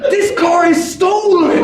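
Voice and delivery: high, drawn-out comic shout; mock outraged yell. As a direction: stretch it out in high-pitched disbelief. Acted outburst at brother.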